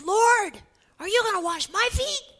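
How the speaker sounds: loud, high, startled question; shocked protest. Acted. Peter protesting as Jesus kneels to wash his feet, shocked and loud. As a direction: blurt it out in disbelief.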